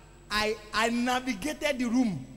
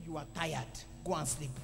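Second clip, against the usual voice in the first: tempting spirit; 11 semitones lower, 9 dB quieter than usual. Retold or imitated. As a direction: lull them toward bed in a low, quiet voice. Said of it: "So one spirit said".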